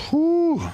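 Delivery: high whistled exhale; dismayed whew. Acted. Interviewer looking over a poor resume, a high dismayed whew. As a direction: let out a high "whew" at bad news.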